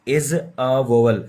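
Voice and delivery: drawn out, word by word; slow claim. Shown as a demonstration. Teacher slowly repeats the false claim. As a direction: say it slowly to test them.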